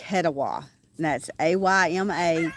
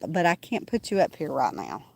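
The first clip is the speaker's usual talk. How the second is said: normal talking voice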